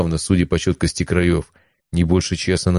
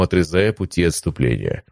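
ordinary delivery, nothing marked

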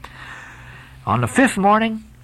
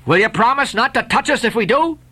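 demanding; 9 semitones higher than usual. Acted. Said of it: "demanded their ringleader".

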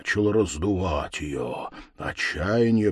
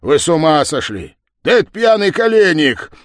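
raised, loud indignation; exasperated outburst; 7 semitones higher, 8 dB louder than usual. Acted. Village head rebukes constables over wrong prisoner, loud exasperation. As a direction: exclaim in loud disbelief.

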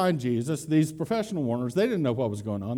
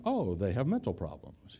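hushed aside; 6 semitones lower, 7 dB quieter than usual. Retold or imitated. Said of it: "a nice way of saying".

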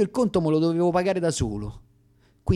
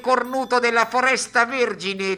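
fairground barker; 10 semitones higher than usual. Acted. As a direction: sell the freak show to passers-by.

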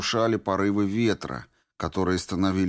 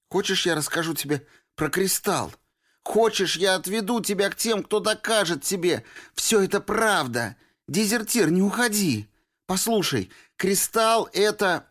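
begging Norris; 12 semitones higher than usual. A narrator's reading. Girl begging the deserter to leave, higher and urgent. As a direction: beg him to flee with you.

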